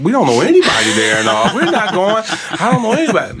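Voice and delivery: loud, high, half-laughing refusal; high, loud mock-indignant no; exasperated refusal.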